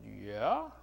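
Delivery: low, drawn-up questioning yeah; puzzled reply. Retold or imitated. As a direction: start low and swoop up, doubtful. Puzzled reply to the diploma offer.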